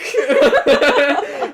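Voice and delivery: high, loud burst of laughter. Real, not acted. Laughing while telling a friend about the fall. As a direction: crack up at the memory.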